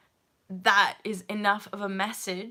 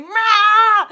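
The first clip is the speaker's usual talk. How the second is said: a huge, raw shout, letting it all out; fearless roar; 10 semitones higher, 17 dB louder than usual. Pretend. Speaker shows her fearlessness with a full-throated shout. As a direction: roar like you've stopped holding back. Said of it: "me being fearless is me going".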